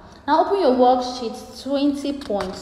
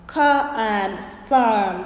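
slow model reading; slower than usual. Shown as a demonstration. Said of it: "And we have".

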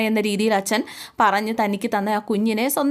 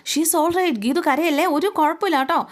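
higher, manic reassurance; unhinged comforting; 6 semitones higher than usual. Retold or imitated. Brother soothing Geethu, lightly voiced unhinged cheer. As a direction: sound falsely cheerful, half out of his mind. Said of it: "മാനസിക നില തെറ്റിയ രീതിയിലാണ്".

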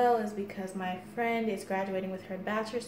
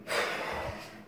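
tired sigh; 5 dB quieter than usual. Real, not acted. Exhausted after the wall sit.

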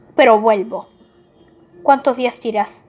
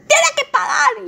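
high angry cry; 4 dB louder than usual. Acted. Demanding the villain pay.

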